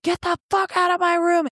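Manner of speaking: high-pitched imitation of an angry order; mock angry yell. Ex throwing her out of the bedroom, imitated high yell.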